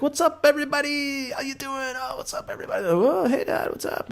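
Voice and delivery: high, cheery mock greeting to the family; goofy greeting voice. Coming home to his kids, silly high greeting. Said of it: "I was like".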